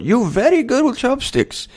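put-on playful Korean-accented praise; imitating Korean accent. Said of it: "she says".

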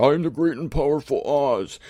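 lightly voiced booming mock wizard; a hint of a grand wizard announcing himself. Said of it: "Wizard of Oz head there".

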